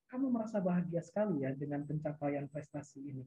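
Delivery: soft, low reflective listening voice; gently mirror the feeling, trailing down quietly; tone matched to listener. Shown as a demonstration. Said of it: "intonasi nada kita juga kita sesuaikan".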